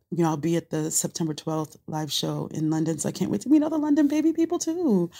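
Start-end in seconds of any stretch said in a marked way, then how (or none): 3.0-5.1 excited. Real, not acted. Excited high rise.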